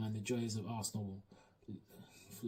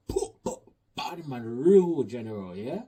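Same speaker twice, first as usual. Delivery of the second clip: hype-man shout; 10 dB louder, slower than usual. Acted. Hyping up Jota's goal.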